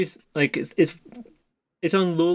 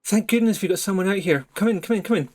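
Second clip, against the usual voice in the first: lightly voiced higher-pitched survivor; welcoming survivor; 4 semitones higher than usual. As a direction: usher them in with a raised pitch. Survivor welcomes group inside.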